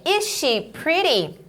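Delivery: measured classroom pronunciation; example being modelled. Shown as a demonstration. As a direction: model the question carefully. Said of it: "Aqui a gente tem".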